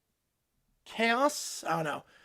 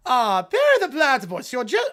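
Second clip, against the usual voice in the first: loud, hammed-up cartoon villain greeting; Doofenshmirtz voice; 6 dB louder than usual. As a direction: greet your nemesis like a cartoon mad scientist. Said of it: "I feel so stupid doing this".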